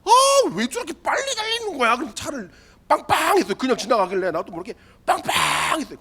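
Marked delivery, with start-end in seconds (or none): 0.0-2.0 high, mock indignant outburst at the other driver; retold anger. Pastor fuming at the driver who clipped his mirror, mock angry. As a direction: play up the flash of road rage, half in jest. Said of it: "막 분노가 막 이렇게 확 올라와서".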